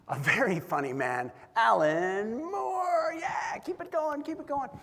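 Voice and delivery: drawn-out name call, high and loud; MC hype intro. Retold or imitated. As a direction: stretch the name out for the crowd like a host. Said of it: "he grabs the microphone and he says".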